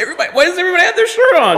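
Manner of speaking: high, very fast laughing voice; rushed mock bewilderment. Friend finding everyone shirtless, high fast and laughing. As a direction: rattle it off in disbelief.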